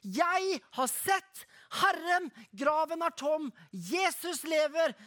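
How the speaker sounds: slow, joyful proclamation; jubilant, drawn-out declaration; exclaiming in pure joy. A narrator's reading. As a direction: announce it to the disciples, overjoyed. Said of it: "i rein glede så utbryter hun".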